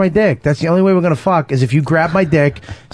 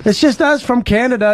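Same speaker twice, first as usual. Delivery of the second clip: mock Canadian accent; 6 semitones higher than usual. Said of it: "always have to say Canada".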